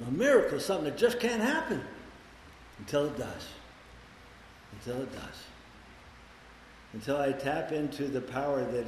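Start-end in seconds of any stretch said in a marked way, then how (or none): 2.8-3.5 softer, sinking down; quiet. Real, not acted. Repeats phrase, dropping voice. 4.7-5.4 much softer echo, almost breathed; near hush. Real, not acted.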